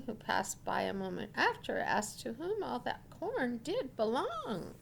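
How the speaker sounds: ordinary delivery, nothing marked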